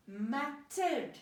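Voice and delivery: MATTERED in two firm beats, MAT, then TERED; split into syllables. Shown as a demonstration. Teacher tackling a long word, MATTERED split in two.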